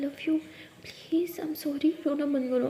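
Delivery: soft, hushed reassurance; tender apology. Acted. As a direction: comfort them quietly and lovingly. Hugging, comforting crying partner.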